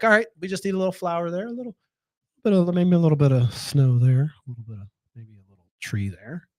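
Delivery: lightly voiced gentle painter, calming himself down; Bob Ross calm. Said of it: "It's like my Bob Ross moment".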